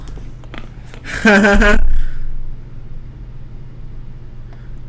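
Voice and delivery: long airy laugh at a joke; real amused laughter; breathy laugh. Real, not acted. Laughing at chat's username.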